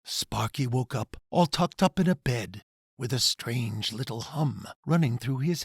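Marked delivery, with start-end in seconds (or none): none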